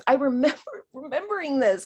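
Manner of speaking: ordinary delivery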